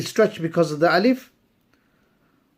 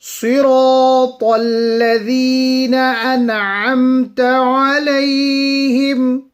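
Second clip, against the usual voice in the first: melodic tajweed recitation, a touch higher; Quran recitation; 6 semitones higher, 4 dB louder than usual. Acted. Teacher reciting the verses for students, melodic and measured. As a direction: chant the verses slowly and evenly.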